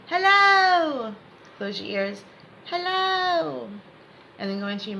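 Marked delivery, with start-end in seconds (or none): 0.1-1.1 high, loud, drawn-out hello. Shown as a demonstration. Coach demonstrating hello for students to hear, out loud. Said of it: "say hello out loud". 2.7-3.7 high, long-held hello; out loud. Shown as a demonstration. Coach repeating hello as students cover their ears, called out. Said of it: "say hello out loud".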